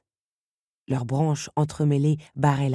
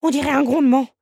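voix de l'araignée; 10 semitones higher, 7 dB louder, faster than usual. Acted. Réponse de l'araignée.

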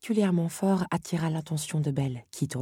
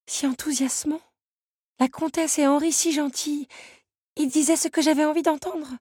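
put-on higher young woman's voice, regretful; Belle remorseful; 9 semitones higher, 3 dB louder than usual. A narrator's reading. Belle admits to Lucanus she trusted the wrong people, regretful. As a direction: confess the error, close to tears. Belle confesses her mistake.

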